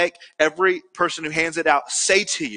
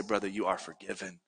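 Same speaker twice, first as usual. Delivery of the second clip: low, soft, tender reassurance; gentle forgiveness; 10 semitones lower, 14 dB quieter than usual. Acted. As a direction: grant pardon softly and low. Acting out forgiving a brother.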